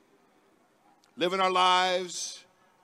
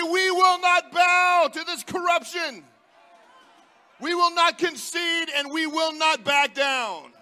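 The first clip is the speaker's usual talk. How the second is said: loud, high defiant chant; defiant shout; 7 semitones higher, 4 dB louder than usual. Acted. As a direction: shout it as a vow. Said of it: "To say".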